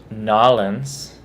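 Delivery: "Nolans" for New Orleans, "NAW-lunz"; local pronunciation. Shown as a demonstration. Mimicking locals, New Orleans as "Nawlins". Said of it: "in that area".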